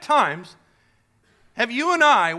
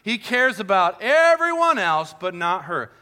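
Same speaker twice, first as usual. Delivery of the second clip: usual speaking voice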